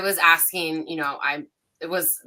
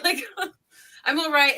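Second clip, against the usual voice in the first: laughing through the words; can't hold back a laugh while saying it; 9 semitones higher than usual. Real, not acted. Medium turning down the ancestors while laughing.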